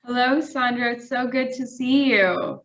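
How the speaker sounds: usual speaking voice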